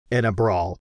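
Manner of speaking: ordinary delivery, nothing marked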